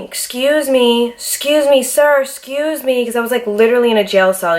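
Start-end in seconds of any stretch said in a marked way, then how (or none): 0.0-3.1 high, slow pleading calls of excuse me; retold screaming. Locked in a cell, calling to the guard, raised high and slow. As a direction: call out to the guard, drawn out and pleading. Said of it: "I was screaming".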